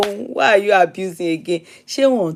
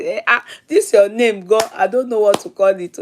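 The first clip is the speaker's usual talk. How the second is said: usual speaking voice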